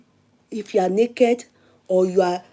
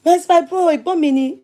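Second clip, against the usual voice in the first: defensive reply; 7 semitones higher than usual. Retold or imitated. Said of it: "mo wò pé".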